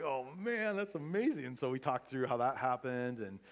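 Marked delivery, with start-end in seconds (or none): 0.0-1.4 excited amazement. Retold or imitated. Retold reaction to good news.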